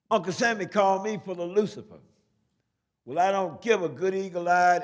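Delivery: full-volume, high-pitched street recital shout. Acted. Poet shouts to hordes of people walking by, shouting out. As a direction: belt out the poem to stop passers-by. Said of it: "and I shouted out".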